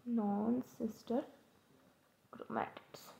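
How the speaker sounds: drawn out softly so students can note it down; slow soft repeat. Shown as a demonstration. Teacher to students, softly and slowly repeating the term for notes. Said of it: "इनको हम बोलेंगे non sister chromatids".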